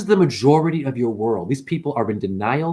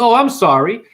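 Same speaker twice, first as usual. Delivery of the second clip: raised, louder imitation of pity; mock sympathy; 7 semitones higher, 5 dB louder than usual. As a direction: mimic an over-concerned apology.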